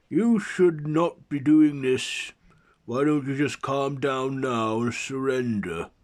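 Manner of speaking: loud, high, forceful appeal; stern challenger. A narrator's reading. Adam telling the mummy king to surrender, loud demand. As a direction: raise the voice and urge surrender.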